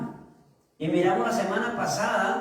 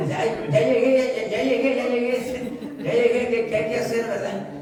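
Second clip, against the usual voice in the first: higher, louder, nervous laughing ya llegué; meek husband; 4 semitones higher, 3 dB louder than usual. Retold or imitated. The beaten husband cowers before his wife, nervous laugh. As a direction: back down fast with a nervous laugh.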